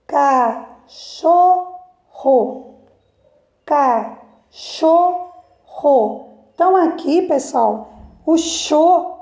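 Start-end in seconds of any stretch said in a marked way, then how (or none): 0.0-5.3 Cachorro drawn out slowly, stressing cho; slow syllable split. Shown as a demonstration. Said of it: "eu vou fazer a primeira com vocês". 8.2-9.1 cho said apart and emphasised; stressed syllable. Shown as a demonstration. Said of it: "é a sílaba mais forte".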